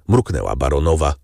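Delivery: ordinary delivery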